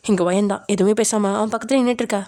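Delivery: ordinary delivery, nothing marked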